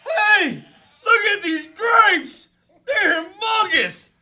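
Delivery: high, loud laughing wonder; excited. Acted. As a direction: shout in delight at grapes. Spy amazed by grapes.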